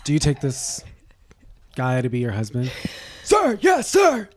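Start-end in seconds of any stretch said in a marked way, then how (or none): 3.2-4.3 barked military reply, much higher and louder; mock drill sergeant shout. Co-host mocks a military wedding vow, shouting like a cadet.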